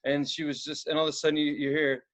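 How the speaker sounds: ordinary delivery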